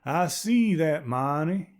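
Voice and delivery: put-on a wary stranger's unplaceable accent; strange rural accent. Man voiced by narrator.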